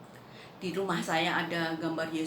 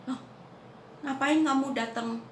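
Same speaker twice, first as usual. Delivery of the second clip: higher-pitched startled question; kaget; 7 semitones higher than usual. Retold or imitated. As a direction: act out being taken aback. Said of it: "saya kaget". (terkejut, heran)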